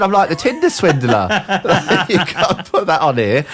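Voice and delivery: high-pitched, loud-edged laughing objection; embarrassed, laughing plea; laughing protest. Real, not acted. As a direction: protest through helpless laughter. Embarrassed friend being teased on air.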